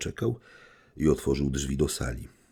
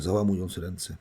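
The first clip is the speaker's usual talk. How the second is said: ordinary delivery, nothing marked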